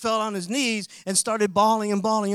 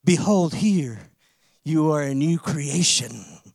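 solemn scripture quote; 4 semitones lower, 5 dB louder, slower than usual. Acted.

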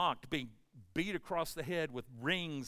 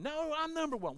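high-pitched mock protest; bickering retort; 11 semitones higher than usual. Disciple arguing back over who is best, high indignant retort. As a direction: swoop up high to contradict a rival. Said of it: "they're arguing about who's the best".

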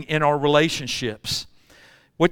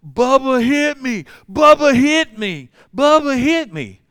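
put-on high, slow, loud wailing four-year-old; whiny little boy; 9 semitones higher, 6 dB louder, slower than usual. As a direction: wail it like a hurt little kid. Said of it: "He's going".

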